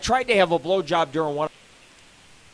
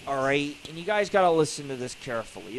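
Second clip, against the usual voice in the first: ordinary talk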